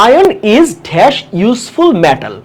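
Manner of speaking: reading the exam item very slowly; slow question read. Shown as a demonstration. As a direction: spell the question out word by word. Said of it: "এটা আর্টিকেলের একটা প্রশ্ন".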